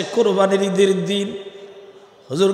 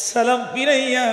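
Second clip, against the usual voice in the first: usual speaking voice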